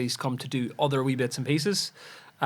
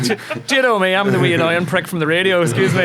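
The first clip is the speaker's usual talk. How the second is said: higher, louder self-important mock swagger; smug local celebrity; 5 semitones higher, 6 dB louder than usual. Radio host mocking his own vanity, loud mock swagger. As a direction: puff yourself up like a minor celebrity. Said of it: "I'm like".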